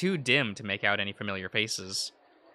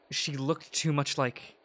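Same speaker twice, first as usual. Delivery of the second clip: normal talking voice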